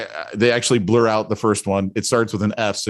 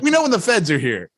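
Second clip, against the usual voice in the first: mock bank robber; 6 semitones higher than usual. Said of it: "We're like".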